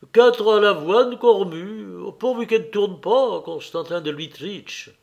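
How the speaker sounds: anxious steward reporting to his master; fretting steward. Acted. Voices the intendant in dialogue.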